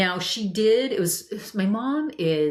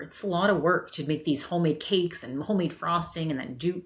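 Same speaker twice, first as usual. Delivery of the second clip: ordinary delivery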